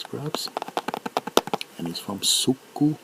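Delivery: normal talking voice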